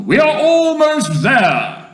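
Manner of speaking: high, loud, drawn-out cheer; full-voiced excited cry; shouting. A narrator's reading. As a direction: holler that victory is near. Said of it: "shouted the old green grasshopper".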